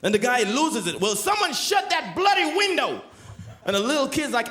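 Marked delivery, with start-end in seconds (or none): 1.0-3.0 a stuffy official shouting in a historic skit; exasperated shout. Acted. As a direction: bark at the room about the window.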